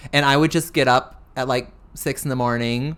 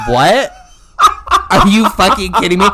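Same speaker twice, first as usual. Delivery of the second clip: loud, high, disbelieving yell; incredulous outburst; 7 semitones higher, 10 dB louder than usual. Retold or imitated. Drag queen reacting to being called Middle Eastern, loud disbelieving yell. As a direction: blurt it out in shocked disbelief. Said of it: "And I'm like".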